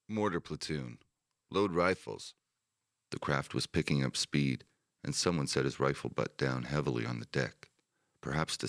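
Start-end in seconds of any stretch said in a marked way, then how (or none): 0.1-2.3 higher-pitched order, called out without a full shout; calling down. A narrator's reading. Lister to his platoon before touchdown, calling down an order. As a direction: project a command to the men below. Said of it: "Lister called down".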